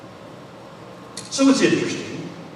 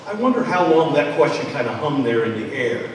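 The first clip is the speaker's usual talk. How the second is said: usual speaking voice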